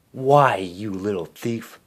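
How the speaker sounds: slow, spiteful stepsister accusation; accusing cry. A narrator's reading. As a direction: drag out the accusation. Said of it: "Drizella cried".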